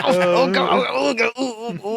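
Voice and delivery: mock pleasure moans, trailing off; mock moaning. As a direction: moan it out as a joke. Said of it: "Brad's just like".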